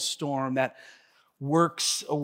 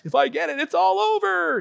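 mock panic; 12 semitones higher than usual. Panicked thought early in lockdown.

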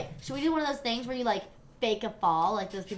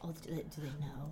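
hushed worried whisper; 7 semitones lower, 13 dB quieter than usual. Real, not acted. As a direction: lean in and whisper. Secret watching in bar.

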